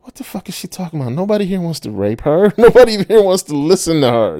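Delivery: high-pitched mock disbelief; acting out baffled, offended onlookers; incredulous. Onlookers react to her in disbelief.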